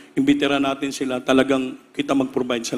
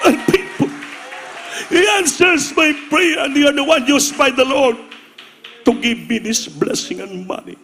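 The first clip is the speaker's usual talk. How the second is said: much higher and louder, slow, crying out in earnest; tearful preaching shout; 12 semitones higher, 4 dB louder, slower than usual. Retold or imitated. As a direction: proclaim it through tears, slow and loud. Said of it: "umiyak na puspos ang mga pastor".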